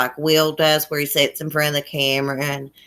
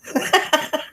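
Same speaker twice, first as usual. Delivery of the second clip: high giggle; 12 semitones higher than usual. Real, not acted. Laughing at annoying voice remark.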